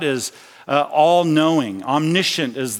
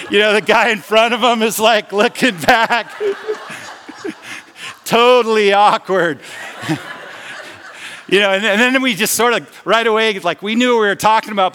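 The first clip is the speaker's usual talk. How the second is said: higher, louder, laughing through the words; real laughter retelling an embarrassing moment; laughing while telling; 6 semitones higher, 4 dB louder than usual. Real, not acted. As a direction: giggle through the awkward bit. Laughing at awkward memory.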